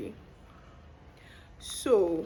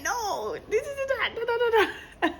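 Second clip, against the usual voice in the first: mock squeaky retort; 13 semitones higher than usual. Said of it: "I was like".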